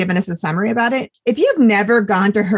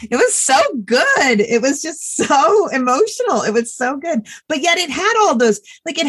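choked up about a moving book; near tears; 7 semitones higher than usual. Real, not acted. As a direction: fight back real tears. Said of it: "I'm gonna cry".

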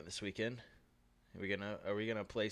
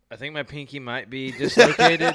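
laughing; 4 semitones higher, 16 dB louder than usual. Real, not acted. Laughing through pain.

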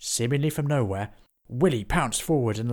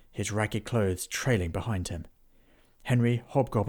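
plain reading voice

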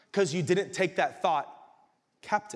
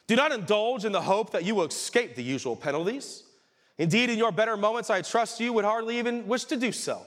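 reading the devil's letter aloud, higher and louder; dramatic letter reading; 7 semitones higher, 3 dB louder than usual. Acted. Preacher reads Screwtape's letter to the congregation, dramatic reading. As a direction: lift the letter off the page with grave relish.

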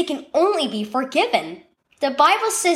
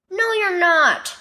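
plain reading voice

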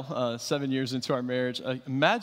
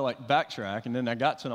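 ordinary talk, nothing marked

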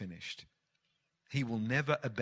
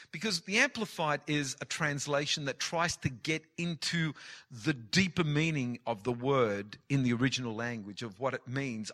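normal talking voice

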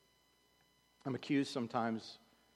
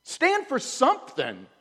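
higher, much louder preacher's cry; fiery exhortation; 12 dB louder than usual. Acted. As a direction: call them out to stand firm. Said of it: "You ever just wanna say that to somebody?".

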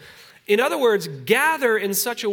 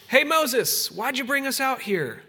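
higher, whiny complaint at Moses; grumbling Israelites; 7 semitones higher than usual. Retold or imitated. Preacher voicing the people grumbling at Moses, whiny and high. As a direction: whine at your leader like a grumbling crowd. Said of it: "the people grumbled".

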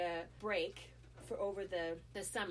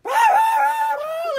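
high, loud rah rah shouts; men shouting; 23 dB louder, slower than usual. Retold or imitated. Neighbor men with machetes surrounding him, loud shouting. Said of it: "like, scre".

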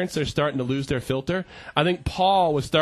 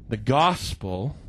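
low, slow scripture read; measured, reverent verse reading; solemn reading; 6 semitones lower, slower than usual. Real, not acted. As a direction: lay each phrase down reverently.

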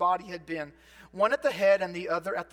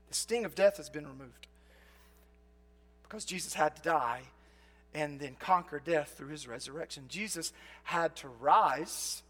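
soft reflective preaching; 6 semitones lower, 7 dB quieter than usual. Acted. Quiet reflective preaching over music.

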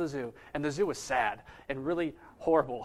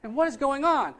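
higher, louder bewildered exclamation; baffled; 8 semitones higher, 5 dB louder than usual. Retold or imitated. Sponsor hearing an unknown name shouted at him, baffled. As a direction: act out being totally confused.

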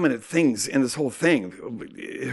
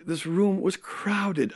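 usual speaking voice

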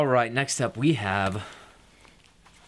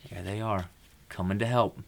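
usual speaking voice

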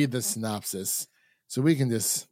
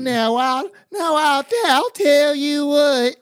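much higher, louder hammed-up Appalachian twang; hillbilly accent; 8 dB louder than usual. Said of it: "like this movie".

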